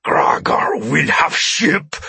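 slow, high, forceful alien voice; drawn-out alien roar; alien's shout. A narrator's reading. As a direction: shout the demand as an alien captor. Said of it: "the Altari shouted".

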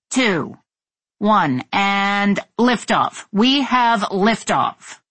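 slow, stretched-out liftoff call; launch announcer. Acted. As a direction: draw out the big liftoff announcement. Mission control announces liftoff.

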